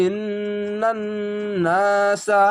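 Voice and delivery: ইন্নান নাসা with the nun held long; Quranic recitation. Shown as a demonstration. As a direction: chant ইন্নান নাসা in tajwid style. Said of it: "শব্দে শব্দে reading পিছন থেকে বারবার". (তিলাওয়াতের সুরে ইন্নান নাসা)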